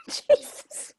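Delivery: laughing exclamation. Real, not acted. Reacting to a joke, laughing.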